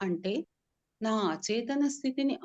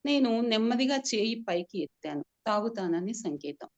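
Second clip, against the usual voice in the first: ordinary delivery, nothing marked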